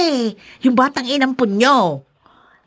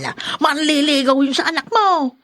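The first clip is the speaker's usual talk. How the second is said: ordinary delivery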